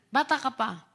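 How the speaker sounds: normal talking voice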